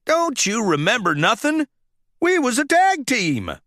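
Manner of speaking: a hint of a folksy tow truck; Mater's voice. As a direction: chide the friend good-naturedly.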